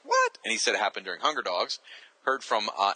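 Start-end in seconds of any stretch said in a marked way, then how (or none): 0.0-0.4 very high, loud squeaked "What?"; shocked what. Retold or imitated. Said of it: "I was like".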